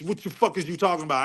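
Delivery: ordinary delivery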